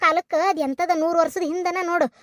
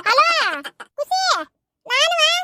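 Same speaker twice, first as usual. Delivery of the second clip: high-pitched shouted hello; calling down the phone; 9 semitones higher than usual. Acted. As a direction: holler to a granddaughter on the line.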